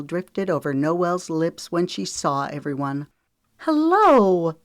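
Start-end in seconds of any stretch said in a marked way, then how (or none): 3.6-4.6 warm hello. A narrator's reading. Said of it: "Zoe said warmly".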